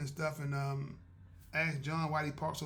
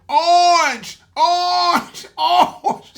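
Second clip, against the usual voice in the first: very high, very loud panicked yell; yelling orange; 14 semitones higher, 21 dB louder, much slower than usual. Retold or imitated. Melanie yelling at the orange barrels from the car, piercing laughing yell. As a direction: shriek it like she's spotting danger. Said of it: "Melanie said".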